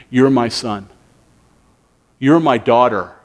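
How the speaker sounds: low tender assurance; tender voice of Jesus. Acted. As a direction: speak to each one as a beloved child. Jesus speaking to listener.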